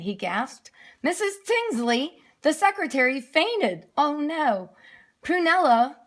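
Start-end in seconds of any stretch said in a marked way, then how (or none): none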